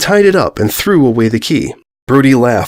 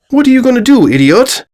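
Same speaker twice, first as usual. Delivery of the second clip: mocking taunt; 11 semitones higher, 4 dB louder than usual. Villain taunts the beaten ponies.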